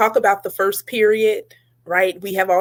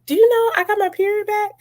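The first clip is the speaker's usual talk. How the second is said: higher quoted voice; 12 semitones higher than usual. Retold or imitated. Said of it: "she was just like".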